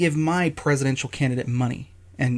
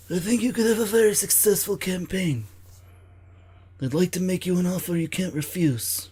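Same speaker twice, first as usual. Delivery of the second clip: higher, hammed-up mafia don offering a deal; Vito Corleone impression; 4 semitones higher than usual. NRA to a candidate, making an offer he can't refuse, in a bad Godfather voice. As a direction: lean into a bad Godfather voice, smooth and menacing. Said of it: "My terrible Vito Corleone impression".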